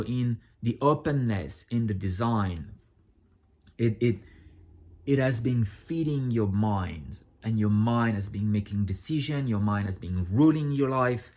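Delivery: normal talking voice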